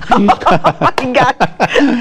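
real laugh through the words. Real, not acted. Laughing at the joke. (riéndose de verdad)

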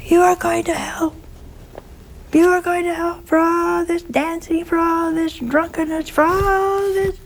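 very high-pitched, sing-out scolding preacher voice; thin falsetto lament over a wayward flock; curé of Ars. Retold or imitated. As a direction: imitate a high-voiced priest warning sinners of hell. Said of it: "had a very high-pitched voice".

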